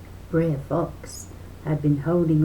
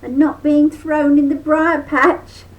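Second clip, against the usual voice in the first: high, loud rabbit pretending relief; high relieved rabbit; mock-relieved rabbit; 10 semitones higher, 8 dB louder than usual. A narrator's reading. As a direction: fake gladness to trick the fox. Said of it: "sighed Brer Rabbit".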